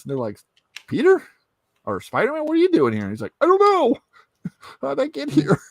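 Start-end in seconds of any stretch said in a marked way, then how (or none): 0.9-3.0 high, loud double take; astonished question. Acted. Heroes to Peter Parker as he takes off his mask, astonished question. Heroes stunned to see Spider-Man. 3.4-5.6 very high, loud squeal; bewildered panic. Acted. Peter Parker answering the heroes, bewildered panic.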